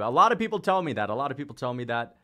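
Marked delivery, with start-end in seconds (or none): 0.0-1.1 higher, louder, fast and cocky; playful boast. Acted. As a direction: toss it out like a brag. Repeats chat line twice.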